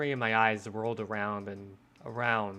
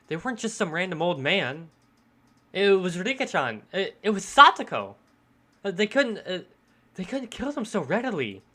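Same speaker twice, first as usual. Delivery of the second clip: higher, louder frantic protest; distressed denial; 8 semitones higher, 7 dB louder than usual. Acted. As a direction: protest desperately, voice rising on each name. Character refuses to believe friends died.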